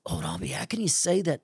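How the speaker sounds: gasp, then a quick whispered protest; mock shock. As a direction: imitate a scandalized listener, hushed and hurried. Voices a shocked listener's objection.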